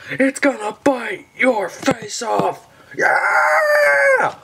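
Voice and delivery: high, excited gushing; thrilled outburst. Real, not acted. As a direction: go wild over a lucky find, high-pitched and drawn out. Pulls an ultra rare card.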